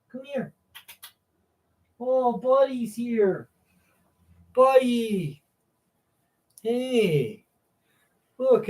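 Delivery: high cooing pet voice; high-pitched doggy talk. Real, not acted. Baby-talking to the dog.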